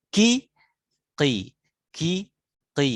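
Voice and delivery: ki very high and loud, throaty; qaf vowel drill. Shown as a demonstration. Teacher drilling qaf sounds for students. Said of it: "Itu ada".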